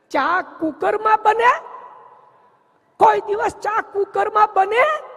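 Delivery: can you believe this, mock outrage; incredulous. Commenter mocking tea in a cooker, loud disbelief.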